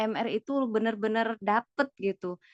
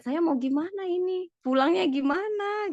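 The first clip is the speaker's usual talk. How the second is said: higher-pitched anxious questioning; retold worry; 9 semitones higher than usual. Retelling being stranded without a ticket home, worried and high. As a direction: relive the fretting, pitch raised.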